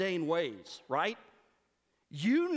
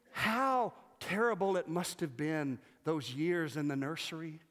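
ordinary talk, nothing marked

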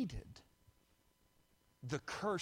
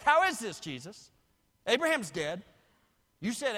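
full-force preaching; 7 semitones higher, 10 dB louder than usual. Acted. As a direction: hurl the accusers' words from the pulpit.

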